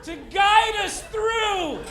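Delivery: very high, loud, drawn-out gushing; mock awe. People online marvel at a dad feeding his children, in hammed-up awe. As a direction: overdo the reverence, nearly singing each word slowly.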